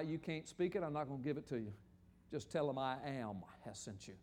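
low, soft, solemn divine answer; God. Acted. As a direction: answer with quiet authority, pitched well down. Voices God answering Moses.

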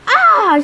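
loud, high scream of rage; frustrated battle scream; acted scream. A narrator's reading. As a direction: cry out in fury, high and loud. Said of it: "she screamed".

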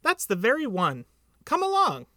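eager, lifting high and loud at the end; eager urging. A narrator's reading. Piglet leads Pooh to the tree, eager call. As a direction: urge them on, voice leaping up.

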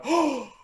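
big mock gasp, high and loud; imitated shocked gasp. Onlookers watching the member's outburst, shocked gasp. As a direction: mimic stunned onlookers gasping. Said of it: "standing there looking at me going".

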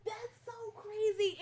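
real tears, voice high and strained; choked up with sudden realization; tearful. Real, not acted. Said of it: "I wasn't trying to be crying".

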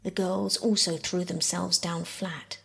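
ordinary delivery, nothing marked

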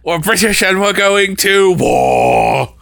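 high, slow mock battle cry; rousing war cry. Comic performing a favorite line, rousing slow war cry. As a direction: belt it out, drawing out the last word.

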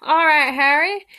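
loud high bellow over the rain. Acted. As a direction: bellow across the platform. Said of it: "Hagrid bellowed back".

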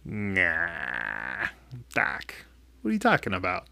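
hushed, low disbelieving scoff; patient scoffing softly at the diagnosis; chuckling dismissal. Retold or imitated. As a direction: brush the doctor off under your breath. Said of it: "I kinda chuckled".

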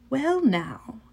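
put-on lightly British-accented, intrigued; strange British-ish accent. A narrator's reading. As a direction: grow interested in a slight British accent. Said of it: "British maybe".

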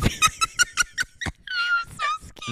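high, slow squeal through laughter; helpless laughing. Real, not acted. Host admitting her fright to co-host, squealing with laughter. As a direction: wail it out through real laughter.

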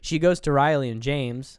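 normal talking voice

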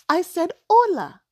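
put-on high, loud child's voice; proudly imitating her little son; proud little boy. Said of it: "He s-, said".

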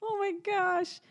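high, slow, doting coo. Real, not acted. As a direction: melt over something adorable. Gushing over baby's hiccups.

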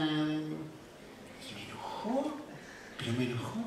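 slow, hushed confession of anger; whispered anger. Retold or imitated. Pastor confessing to the congregation, slow whisper. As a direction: drop to a slow whisper as if admitting a secret.

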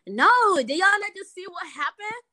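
high, loud mock outrage; retold indignant refusal. Upset dancer refuses her teammates, indignant retold outburst.

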